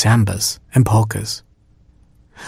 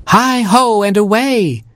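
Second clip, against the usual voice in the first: high, sustained rallying call; crying out; 11 semitones higher than usual. A narrator's reading. Cinder Elephant sets her pumpkin coach off, crying out high. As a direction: sing out a send-off high above your usual voice. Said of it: "cried Cinder Elephant".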